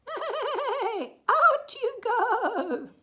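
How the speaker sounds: high, loud, slow whinnying command; whinny as you drive them out; neighing horse. A narrator's reading. Horse chasing the goats.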